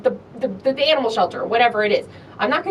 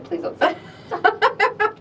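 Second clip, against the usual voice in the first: repeated through a laugh; laughing repeat of the plea; 12 semitones higher than usual. Retold or imitated. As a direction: say it laughing at yourself.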